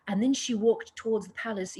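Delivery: quick imitation of a British accent, reading narration; mock British storyteller lilt. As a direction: put on a British accent to read a line of prose. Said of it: "in a British accent".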